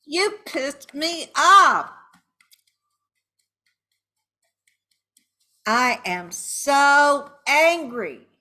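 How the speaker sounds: loud, slow, high-pitched fury; angry outburst. Shown as a demonstration. Venting at the cheater, loud slow anger. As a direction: hit every word hard and drag it out. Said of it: "raise your voice".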